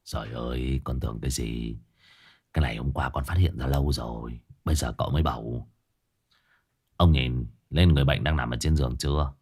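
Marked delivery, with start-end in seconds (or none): 0.0-5.7 lower, softer dismissive scoff; sulky, unimpressed servant; pouting scoff. A narrator's reading. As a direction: brush it off as old news. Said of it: "Hải Nghẹo bĩu môi".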